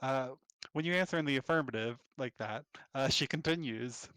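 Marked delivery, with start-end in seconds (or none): none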